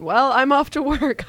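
sing-song casual goodbye, pitched high; Chelsea's breezy exit line. Retold or imitated. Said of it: "her just going".

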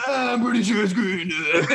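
mock dumb friend. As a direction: play the friend as a dopey know-it-all. Mocking clueless friend's reply.

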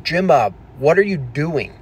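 pleading, incredulous telling-off; exasperated scolding. Retold or imitated. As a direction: imitate exasperated viewers telling him off. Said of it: "people are like".

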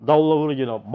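usual speaking voice

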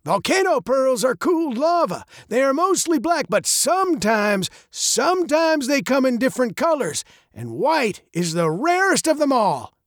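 put-on high, gravelly old man's voice. A narrator's reading. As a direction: muse like a muddled old man, high and gravelly. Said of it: "his voice as old and gravelly as his face".

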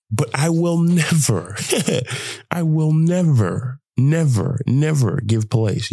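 slow, NEVER leaned on each time; emphatic vow. Real, not acted. Vlogger vowing never to give in, slow stressed never. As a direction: hammer never, slowly.

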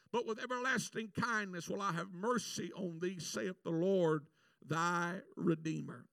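thundering scripture delivery; full-force preaching. Acted. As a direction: preach it over the congregation. Preaching builds.

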